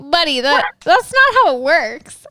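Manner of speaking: exasperated correction. Pretend. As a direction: set a clueless stranger straight, exasperated. Mock scolding of bad mask wearers.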